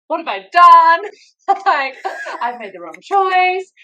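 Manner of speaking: high, loud mock wail of regret; retold panic with a laugh breaking in; stressed panic. Second-guessing her choice of care, stressed panic.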